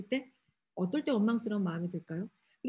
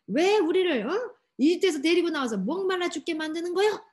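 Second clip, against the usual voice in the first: high, loud, indignant outburst; acted-out angry accusation, pitched high; loud resentful complaint; 7 semitones higher, 5 dB louder than usual. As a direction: protest at full voice like a furious crowd. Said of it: "더 큰 소리로 원망해요".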